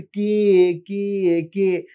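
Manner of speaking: put-on long playful asking for a child; drawn-out question. Shown as a demonstration. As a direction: ask slowly so a toddler can copy. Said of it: "টেনে টেনে বলবো".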